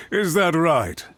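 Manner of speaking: ordinary delivery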